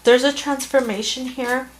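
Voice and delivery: high, tearful voice on the edge of breaking; fighting back tears while reassuring them; choked up. Real, not acted. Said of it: "Sorry, I got a little emotional".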